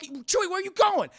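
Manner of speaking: high, loud exasperated call; exasperated shout. Acted. Friends yelling after wandering Chewie, exasperated shout. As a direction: yell after him, voice shooting up.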